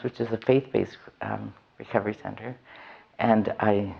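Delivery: ordinary talk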